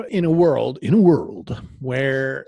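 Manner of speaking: slow "in a world" trailer read; a hint of a trailer announcer; mock trailer voice. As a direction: drag out "in a world" like a movie trailer.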